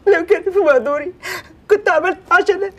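hurt, teary pleading; tearful whimper. Acted. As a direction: voice catching on the edge of sobs. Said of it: "انت بتعيط ليه دلوقتي يا [name]؟".

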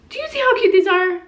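high, fast gushing; squealing show-and-tell; excited. Real, not acted. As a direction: gush over the shoes. Excited showing new shoes.

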